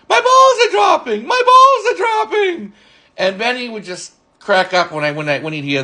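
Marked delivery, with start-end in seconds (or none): 0.1-2.7 very high, hammed-up cry of alarm; mock panic. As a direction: squeal it like a panicking cartoon hen. Mock panicked Henny Penny impression.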